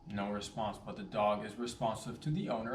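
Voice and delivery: ordinary talk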